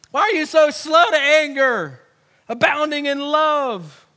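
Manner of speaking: acted furious prophet railing; ticked off. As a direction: hammer it out as an outraged accusation, stretching key words. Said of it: "Jonah is ticked off about it".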